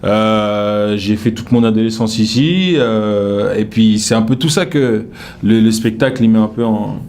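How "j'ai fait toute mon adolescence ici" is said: usual speaking voice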